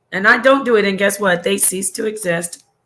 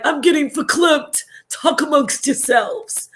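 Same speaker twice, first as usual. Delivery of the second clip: higher-pitched, hammed-up verklempt voice; SNL sketch character; 6 semitones higher than usual. Streamer shows viewers the Saturday Night Live clip, hammed-up impression. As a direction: mimic a sketch host overcome with feeling, drawing out the last phrase. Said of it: "where she's like".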